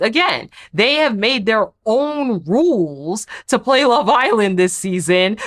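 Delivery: ordinary talk, nothing marked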